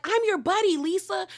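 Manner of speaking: high-pitched mock pleading; mimicked protest. Recapper mimicking Heather insisting she is a buddy, high-pitched protest. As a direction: imitate an insistent friend, pitched well up.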